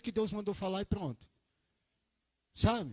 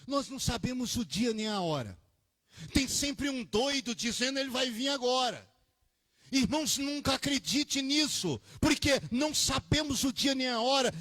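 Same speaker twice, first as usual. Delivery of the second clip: rising, loud preacher's cadence; fervent sermon delivery; preaching at full force; 7 semitones higher, 4 dB louder than usual. Acted. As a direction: preach it out to the congregation, building up.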